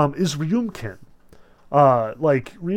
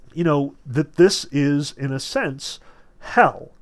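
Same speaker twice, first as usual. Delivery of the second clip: ordinary talk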